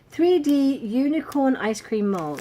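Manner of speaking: loud, high and drawn-out; grand product announcement. Acted. Vlogger reads out her box item, grandly announced.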